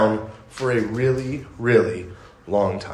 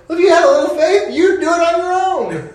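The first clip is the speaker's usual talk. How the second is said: high-pitched, comically exasperated comeback; mock retort; 12 semitones higher than usual. Jesus answering believers who wait on God, high mock exasperation. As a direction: throw it back in a raised mock voice.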